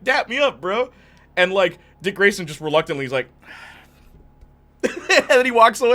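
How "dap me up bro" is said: put-on eager kid asking for a dap. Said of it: "like, like".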